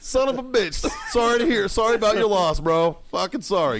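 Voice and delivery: high, loud fake condolences; hammed-up sorrow, mocking him; mock sympathy.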